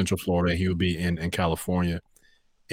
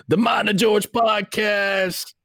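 high, drawn-out yelled announcer voice; radio station ID; 14 semitones higher, much slower than usual. Shown as a demonstration. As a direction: belt out a station ident, stretching every word. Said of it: "like, a radio station, um, I D".

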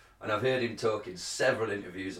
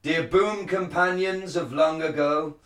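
higher, slow, grand stage declaiming; Shakespearean actor; 3 semitones higher than usual. Shown as a demonstration. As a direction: proclaim it like a druid priest. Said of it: "like a Shakespearean, um, uh, actor".

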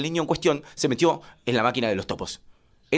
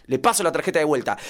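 ordinary talk

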